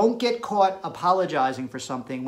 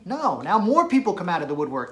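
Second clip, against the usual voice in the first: ordinary delivery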